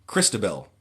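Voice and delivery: ordinary talk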